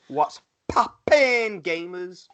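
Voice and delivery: high, drawn-out mock streamer intro; hammed-up greeting. Wrestler greeting podcast listeners, hammed-up and drawn out. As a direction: stretch it out high like a showy catchphrase.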